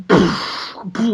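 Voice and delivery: breathy "poof, poof" for footsteps; mouth stomp noise. Shown as a demonstration. Performer making dinosaur footsteps, breathy puffs. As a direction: puff out the stomping sound. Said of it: "with your mouth".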